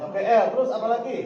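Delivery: lively re-enacted coaching; encouraging prompting. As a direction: prod a pupil for the next letter. Teacher prompting child for next letter.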